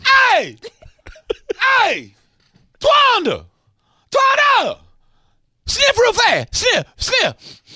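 high, loud call to a neighbor; excited neighbor yell. Acted. Host yells to his neighbor Ryan about the bacon smell.

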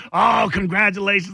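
mock gushing reply-all; put-on congratulatory coo; imitated congratulations. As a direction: mimic a reply-all well-wisher. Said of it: "when it's a baby announcement".

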